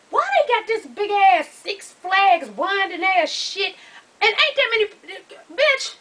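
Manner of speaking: high, indignant rant; exasperated complaint. Retold or imitated. Storyteller facing a long winding line, exasperated. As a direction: pitch it up in comic disbelief at the queue.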